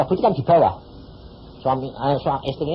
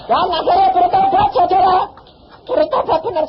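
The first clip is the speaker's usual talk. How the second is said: preacher laughing at his own story. Real, not acted. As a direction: laugh it out, then announce to the congregation.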